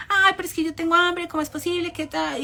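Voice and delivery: high-pitched mock complaint; whining girl. Girl protesting to the bossy woman, mock whining. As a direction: imitate a girl whining back. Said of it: "la china le decía".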